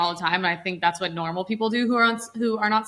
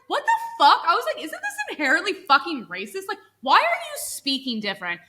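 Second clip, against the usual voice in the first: high-pitched incredulous retelling; retold outraged disbelief; 7 semitones higher than usual. Recalling her reaction to the manager's switch, mock-appalled high voice. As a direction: act out being appalled, pitched well up.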